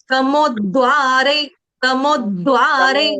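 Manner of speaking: तमोद्वारे with the closing e drawn out, not cut short; slow तमोद्वारे, full final vowel; slow pronunciation demo. Shown as a demonstration. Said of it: "तमोद्वार नहीं बोलेंगे".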